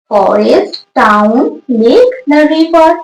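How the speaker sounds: ordinary talk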